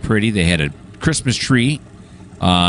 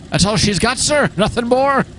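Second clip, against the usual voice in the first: high, hammy Scottish engineer voice; a rough Scottish brogue, pitched way up; Scotty impression. Engineer reporting to his captain in a Scottish accent. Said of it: "That's my best Scotty impression".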